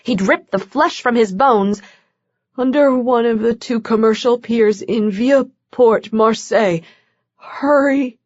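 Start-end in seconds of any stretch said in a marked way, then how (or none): 2.6-8.2 weak, pleading, rushed; weak urgent voice. A narrator's reading. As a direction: give directions weakly, desperate. Weak man giving location.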